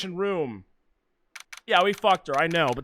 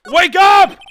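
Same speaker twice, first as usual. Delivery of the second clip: shouted high and loud; 10 semitones higher, 17 dB louder than usual. Acted. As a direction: bellow to wake the sleeper. Shouting at sleeping character.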